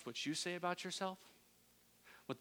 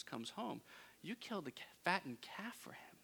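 resentful mutter; 2 semitones higher, 8 dB quieter than usual. Retold or imitated. Older son's resentful hush.

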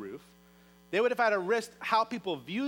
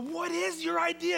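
eager question; 10 semitones higher than usual. Acted. Friends demand to hear the plan.

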